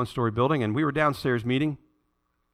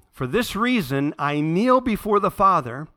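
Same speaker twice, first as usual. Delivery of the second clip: slow, measured Bible reading; scripture reading; 5 semitones higher, 4 dB louder than usual. Acted. Pastor reading Scripture aloud to his church, slow and measured. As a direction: read the verse with reverence.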